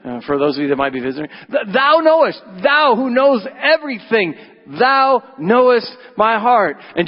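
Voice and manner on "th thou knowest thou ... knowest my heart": high, loud solemn King James voice; fervent archaic plea; King James Peter. Acted. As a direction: voice Peter in old-Bible style, high and ringing. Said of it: "I don't say thou very often".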